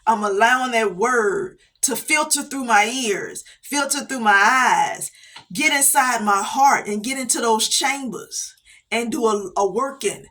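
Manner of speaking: ordinary talk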